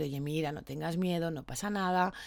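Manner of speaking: put-on soft, gentle reassurance to a scared child. Adult calming a frightened child at the clinic, soft and soothing. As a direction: soothe him quietly so he stops fearing. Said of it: "le vas a hablar con voz suave".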